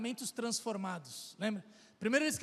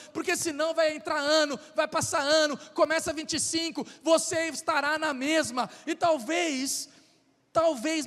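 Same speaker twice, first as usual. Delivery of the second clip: rising, louder sermon cadence pressing the church; preaching at full force; 3 semitones higher, 4 dB louder than usual. Acted. Preacher urging the church toward change, building and louder. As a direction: preach it hard, building urgency to the congregation.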